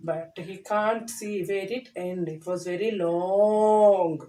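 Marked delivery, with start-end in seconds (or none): 2.4-4.2 slow, lower stretch on long; drawn out. A narrator's reading. Telling children how long the nose grew, slowed down. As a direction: draw out very long.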